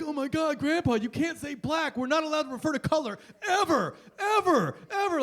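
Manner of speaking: high, loud, whiny scolding; horrified grandkids. Acted. Grandkids scolding Grandpa for what he said, horrified. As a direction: escalate in pitch with each shocked word. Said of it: "your grandkids are gonna be horrified".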